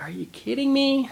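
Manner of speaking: high-pitched incredulous question; retold disbelief. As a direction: act out exasperated disbelief, pitch way up. Teacher reacts to kid's prank.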